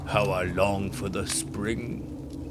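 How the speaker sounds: slow, soft, sighing giant voice; long for spring with a heavy sigh. A narrator's reading. Lonely giant by the fire, softly sighing. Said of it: "the giant sighed".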